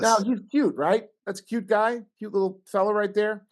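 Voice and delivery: high, sweet cooing over a cute animal; cooing baby talk. Acted. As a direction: gush like talking to a pet. Cooing over raccoon photo.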